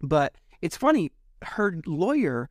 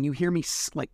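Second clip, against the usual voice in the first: ordinary talk, nothing marked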